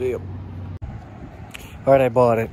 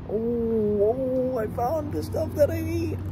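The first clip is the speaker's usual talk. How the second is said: high, squealing with delight; thrilled, pitch jumping way up; excited; 12 semitones higher, slower than usual. Real, not acted. Finding the item he wanted.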